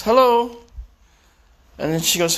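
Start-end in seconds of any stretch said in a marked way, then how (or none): 0.0-0.6 high, lilting hello into the phone; mimicked phone greeting. Politician taking the son's phone from him, high mimicked hello. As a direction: answer the phone in a raised pitch. Politician answers the mother's call.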